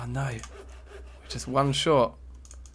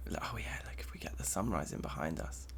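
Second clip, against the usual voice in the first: hushed whisper; 5 semitones lower, 13 dB quieter than usual. Real, not acted. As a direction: whisper it so you don't spoil the moment. Hushed moment before a sunrise view.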